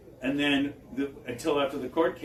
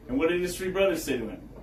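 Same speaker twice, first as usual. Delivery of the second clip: ordinary delivery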